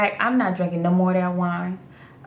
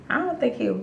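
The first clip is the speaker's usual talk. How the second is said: usual speaking voice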